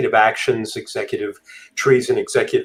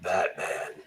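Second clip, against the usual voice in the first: low, hushed Batman growl; very soft and low, like Batman; Batman impression; 6 semitones lower, 12 dB quieter than usual. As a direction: drop to a dark, whispered superhero voice. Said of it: "read ... really kinda like, "Batman".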